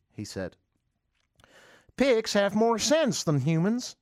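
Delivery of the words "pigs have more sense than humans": slow, higher mocking preacher voice; mock pastor quoting scripture; mock preacher quote. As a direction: parody the pastor's pompous line.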